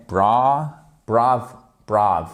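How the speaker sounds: brother built up in separate pieces, "bra, brav"; syllable breakdown. Shown as a demonstration. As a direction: isolate the stressed start of brother, piece by piece. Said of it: "sur la première syllabe". (début de brother découpé, syllabe accentuée isolée)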